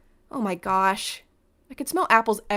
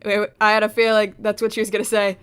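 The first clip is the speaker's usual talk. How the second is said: louder, eager I-knew-it blurt; proud I-called-it interruption; excited aside; 7 dB louder than usual. Real, not acted. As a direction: cut in thrilled you called it. Excited aside on predicted line.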